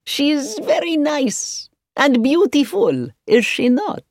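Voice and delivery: high, slow, delighted praise; joyful. A narrator's reading. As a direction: draw out the compliment with high-pitched joy. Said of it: "said Marcus joyfully".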